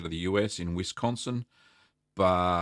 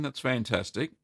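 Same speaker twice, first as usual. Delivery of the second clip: ordinary talk, nothing marked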